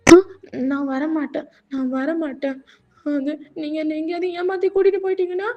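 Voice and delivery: acted plaintive protest; whiny tearful junior. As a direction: whine suspiciously at a senior.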